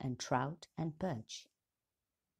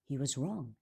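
ordinary delivery

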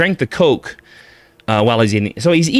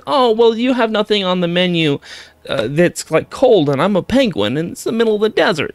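higher, nasal little penguin voice; 8 semitones higher than usual. Retold or imitated. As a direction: whine like a fussy nasal penguin. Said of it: "this is kinda how he sounds. it's kinda a little nasal".

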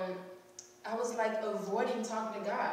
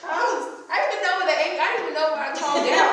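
loud, higher, tumbling out with a laugh; excited, laughing disbelief; laughing amazement; 5 semitones higher, 8 dB louder than usual. Real, not acted. Amazed at own change, laughing.

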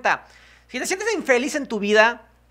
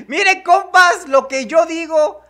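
gloating laugh; 6 semitones higher, 6 dB louder than usual. Real, not acted. Gloating about being right.

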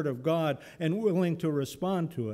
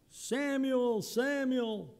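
high, far-off calling voice; voice of God calling; 10 semitones higher, much slower than usual. Pretend. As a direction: call the name from above. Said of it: "my reading of it would be".

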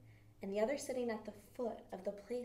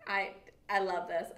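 ordinary delivery

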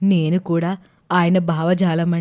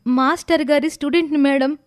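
put-on higher, girlish young woman's voice; young Dhatri; 8 semitones higher than usual. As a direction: speak up brightly as the young student. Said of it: "నా పేరు ధాత్రి అండి". (పైస్థాయి యువతి గొంతుతో ధాత్రి)

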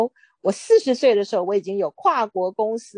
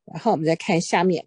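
ordinary delivery

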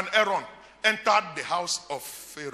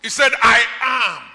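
loud, rising preacher's cry to the congregation; preaching at full force; 4 semitones higher, 11 dB louder than usual. Acted. Preacher proclaiming God's name to the church, at full force. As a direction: preach it out, driving every line home.

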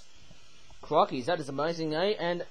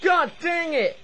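high, loud comic outburst in a put-on voice; hammed-up cartoon exasperation; mock frustrated shout; 8 semitones higher, 7 dB louder than usual. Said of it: "Trackmaster Luke keeps on taking over".

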